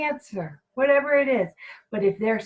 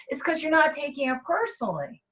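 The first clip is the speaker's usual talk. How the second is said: usual speaking voice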